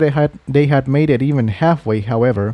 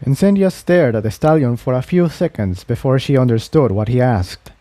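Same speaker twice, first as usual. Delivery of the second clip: ordinary delivery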